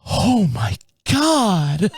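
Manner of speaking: very slow, drawn-out aghast gasp; mimicked shock. Young mom stares at the driver, mock horror drawn out slowly. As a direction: imitate a stunned onlooker, stretching every word. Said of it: "she's looking right at me like".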